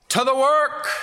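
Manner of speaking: put-on a high, loud old man's rallying call; rousing old man's cry. Old Fred rallies the young pastor to go serve, high and loud. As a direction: pump your fist and send everyone off to the work. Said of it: "he would say".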